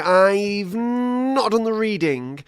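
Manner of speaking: high, drawn-out mock pleading; whiny imitation. Co-host begging to record later, whiny imitation.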